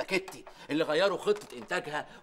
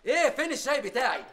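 much higher, louder bark; angry yell; 10 semitones higher, 7 dB louder than usual. Acted. Foreman yells at the tea boy, loud.

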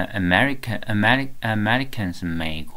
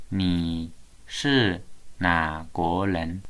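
你是哪国人 spoken slowly, each syllable clear for the class to repeat; slow teacher model for students to copy; slow model phrase; much slower than usual. Shown as a demonstration. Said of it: "要follow me".

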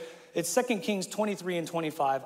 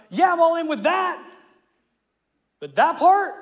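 much higher and louder, sarcastic caricature; mock the half-hearted reader; mocking picky believer; 10 semitones higher, 9 dB louder than usual. Pastor mocking churchgoers who pick verses, shrill sarcastic impression. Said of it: "they're like".

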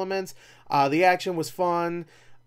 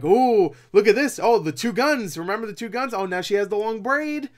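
higher, louder gushing over the references; excited fan callout; 6 semitones higher, 5 dB louder than usual. Retold or imitated. Movie nudging game fans, high and gushing. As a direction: point out each Easter egg like a thrilled fan. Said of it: "they're hinting like".

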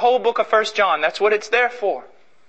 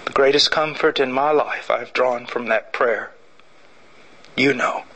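prayer; 6 semitones lower, 4 dB quieter than usual. Acted. Preacher leading the church in closing prayer, low and soft. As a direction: pray quietly for the congregation. Let's pray.

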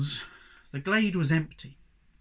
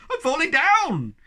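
high, loud cry of alarm; 13 semitones higher, 9 dB louder than usual. A narrator's reading. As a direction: cry out as if falling. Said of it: "he burst out in alarm".

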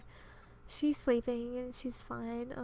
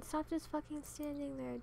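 ordinary talk, nothing marked

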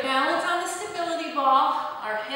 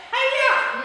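high, loud martial-arts "hi-ya"; mock karate kick yell; playful karate cry; 11 semitones higher, 6 dB louder than usual. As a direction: shout it as you kick.

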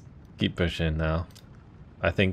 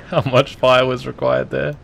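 amused, higher and louder; laughing disbelief; 6 semitones higher, 7 dB louder than usual. Real, not acted. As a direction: laugh through the question. Laughs at overkill.

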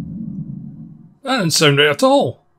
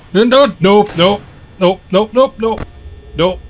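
panicked no; 4 semitones higher than usual. Real, not acted. Animatronic approaching in dark.

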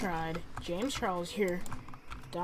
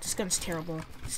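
ordinary talk, nothing marked

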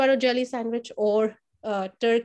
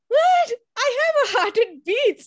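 much higher, mock-offended protest; indignant; 13 semitones higher, 6 dB louder than usual. Teacher retelling her mock-offended protest to the kids, much higher. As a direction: squeal it out as if insulted.

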